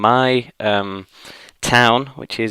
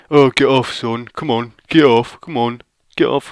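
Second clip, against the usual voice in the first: higher-pitched, insistent mock ordering; impatient conductor; 3 semitones higher than usual. Conductor ordering a kid off the train, mock impatient. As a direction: play a conductor shooing someone off.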